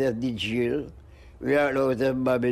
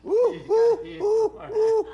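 very high falsetto hoots; playful monkey calls; 4 dB louder than usual. Retold or imitated. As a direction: hoot like a monkey. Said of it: "says the monkey".

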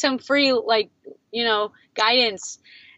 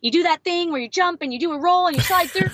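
imitating the coach, much higher and fast; gushing fan; 7 semitones higher, faster than usual. Coach telling him what her kids watch, excited and fast. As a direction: rattle it off like a thrilled fan.

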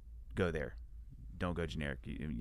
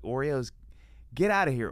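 loud, scoffing get outta here; louder mock disgust; mock outraged yell; 6 semitones higher, 7 dB louder than usual. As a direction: brush the cookies off, loudly and higher. Mock outrage at generic cookies.

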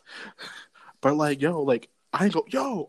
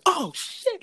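excited exclaim. Retold or imitated. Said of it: "I was like".

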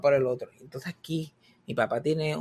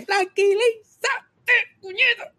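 high, slow, louder mock yell of a nervous dad; dad losing it; 4 dB louder, much slower than usual. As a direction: impersonate the frantic father shouting at him to calm down. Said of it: "¿eh?". (imitando al papá nervioso gritando, agudo y lento)

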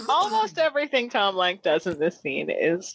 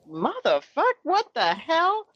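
mock outraged; 9 semitones higher, slower than usual.

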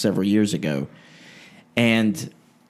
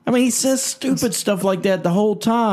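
very high, cartoonish mimic voice; put-on preacher impersonation; 11 semitones higher than usual. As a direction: comment in a hammed-up parody voice. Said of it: "pretty good interpretation there".

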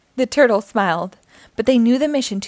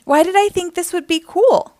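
high-pitched incredulous question; regretful complaint; 8 semitones higher, 3 dB louder than usual. A narrator's reading. Raphael regrets the space trip, regretful complaint. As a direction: ask it high, full of regret.

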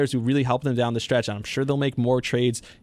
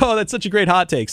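higher mock-admiring dad voice; imitating dad; 8 semitones higher, 4 dB louder than usual. Father mock-praising his son's hot take, higher teasing voice. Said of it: "he always is like".

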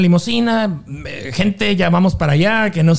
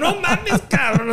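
laughing disbelief; 8 semitones higher, 3 dB quieter, slower than usual. Retold or imitated. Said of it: "Le digo".

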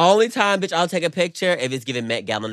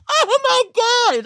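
excited squeal; 5 dB louder than usual. Pretend. Dreaming of fame.

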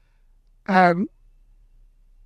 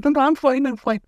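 much higher, quick no no I'm fine; higher, mock-breezy refusal; eager student; 10 semitones higher than usual. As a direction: brush off the teacher like a keen kid.

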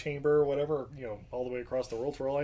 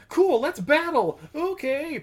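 eager challenge; 12 semitones higher, 4 dB louder, slower than usual. Retold or imitated.

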